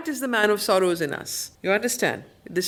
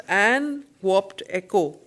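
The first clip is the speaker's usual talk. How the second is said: ordinary talk, nothing marked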